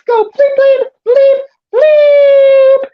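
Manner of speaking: very high, held censor beep; imitated bleep. Host mimicking skeptics going bleep, high beeping voice. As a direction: squeal out beeps, the last one longest and loud.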